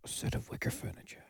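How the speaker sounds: deadpan whispered suggestion. Acted. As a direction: slip it in under your breath.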